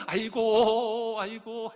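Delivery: very slow, loud drawn-out 아이고; hired mourner wailing as another guest arrives; mourning wail. Shown as a demonstration. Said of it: "또 오면은".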